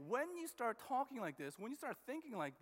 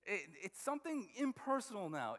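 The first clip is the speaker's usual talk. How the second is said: normal talking voice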